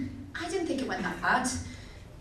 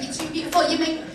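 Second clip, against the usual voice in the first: sarcastic retort; 6 semitones higher than usual. Retold or imitated. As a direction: scoff at it in exaggerated disbelief. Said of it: "mocking mum's explanation".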